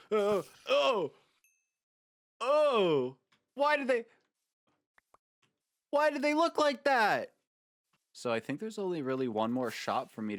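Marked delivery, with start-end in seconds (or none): 0.1-7.3 high, loud squeal of disbelief; shocked squeal. Real, not acted. Seeing strange figures in the shop, a high shocked shriek. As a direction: shriek at how ugly they look.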